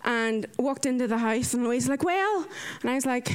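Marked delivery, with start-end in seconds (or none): 2.0-2.5 eager greeting. Retold or imitated. Housemate's eager greeting, retold.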